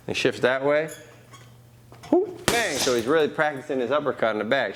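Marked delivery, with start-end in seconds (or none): 2.1-2.8 high punchy rhythm calls; whoop and bang calls. Shown as a demonstration. Coach vocalizing the combo.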